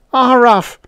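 high, loud howl ending in a bark-like syllable; native's howl. A narrator's reading. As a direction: howl like an excited alien. Natives cry while throwing rocks.

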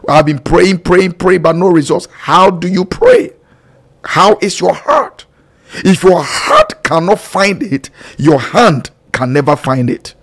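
normal talking voice

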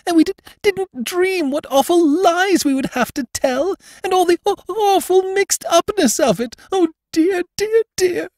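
put-on tearful young bride stuttering between sobs; sobbing. A narrator's reading. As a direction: choke out the words while crying. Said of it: "sobbed Missus Brede".

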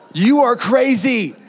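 slow, drawn-out incredulous reaction; mock disbelief. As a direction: stretch each word in playful disbelief. Imagined reaction to pastor's war announcement.

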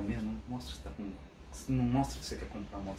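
partner's soft, low warning so the seller can't hear; hushed warning. Retold or imitated. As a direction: drop to a soft, low hush. Wife warning him at the sale.

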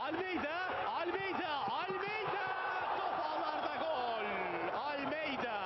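excited goal call. Real, not acted. Commentator calls equaliser goal.

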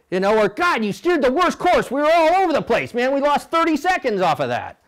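high, loud blaming rant; mock-furious rower chewing out the cox; angry rower's rant. Rower blaming the coxswain after a lost race, high and loud.

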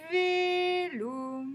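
Vélo stretched high, both vowels held; drawn-out syllables. Shown as a demonstration. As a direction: sing out Vélo as two long syllables. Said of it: "on va chercher tous les mots".

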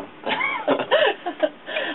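short sheepish laugh; embarrassed laugh. Real, not acted. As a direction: laugh it off shyly. Guitarist laughs about old girlfriend.

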